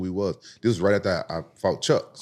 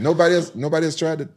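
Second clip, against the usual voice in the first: usual speaking voice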